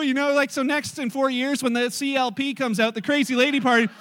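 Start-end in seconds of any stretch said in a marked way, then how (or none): none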